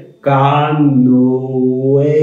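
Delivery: "कानोए" drawn out slowly, as if the ending were said twice; slow stretched word. Shown as a demonstration. Teacher stretches "कानोए" slowly for students. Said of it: "ऐसा लगे जैसे दो बार बोल रहे हैं".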